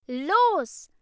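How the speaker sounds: sharp, high, loud command, slowed on the second word; angry demand. A narrator's reading. Ida demands the class answer her, angry and loud. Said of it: "zischte Ida".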